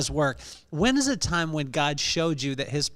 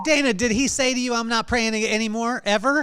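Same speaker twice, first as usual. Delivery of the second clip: higher-pitched teasing question; joking; 6 semitones higher, 4 dB louder than usual. Pretend. Co-host ribbing the mother about her son's prayer, teasing. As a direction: tease with mock concern, pitched up. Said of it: "I'm joking with you".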